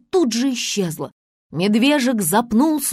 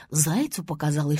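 ordinary delivery, nothing marked

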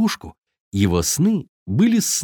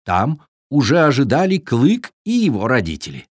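ordinary delivery, nothing marked

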